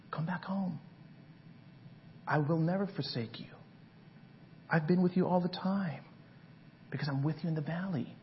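soft, near-whispered reassurance; God whispering comfort; tender hushed God. Acted. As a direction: comfort the wanderer quietly. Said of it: "And God says".

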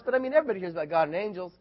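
normal talking voice

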